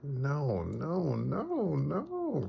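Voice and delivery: acted high, loud wailing plea; drawn-out mock cry of distress; anguished wail. Retold wife agonising over the killing.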